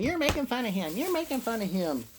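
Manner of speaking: high, nagging imitation of wife; wife's mock complaint. Imitating wife scolding him about the voice. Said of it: "my wife can't stand".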